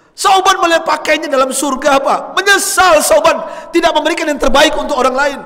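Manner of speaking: high, loud sermon voice; preacher hammering home the story's lesson; preaching at full force. Acted.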